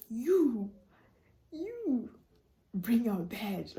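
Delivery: lightly voiced stern teacher. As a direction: call her out like a strict teacher. Teacher ordering her over.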